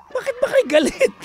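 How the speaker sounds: high, loud exasperated cry; mock outrage. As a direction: play up the outrage, half laughing. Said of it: "Ako yung galit eh". (kunwaring galit na sigaw)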